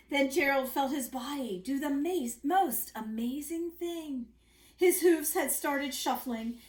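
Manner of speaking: storybook read-aloud voice; reading to children. A narrator's reading. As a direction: read it like a bedtime book. Reading picture book aloud.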